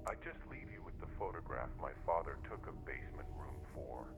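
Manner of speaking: hushed, careful warning; letter read aloud. A narrator's reading. Son warns family about the photograph, hushed letter voice. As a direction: read softly, as a written caution.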